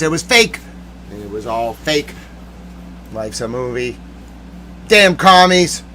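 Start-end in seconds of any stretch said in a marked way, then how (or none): 1.1-4.0 lower, softer resignation; deflated. Real, not acted. Giving up after ranting about fakery, deflated. 4.9-5.8 higher, louder curse; mad. Real, not acted. Cursing the commies between sulks, mad. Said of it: "I'm mad".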